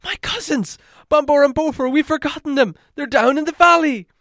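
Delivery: high and loud, panicked; dwarf crying out that his cousins are left behind; alarmed cry. Acted. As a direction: cry out in sudden dismay. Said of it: "Bufur gave a cry".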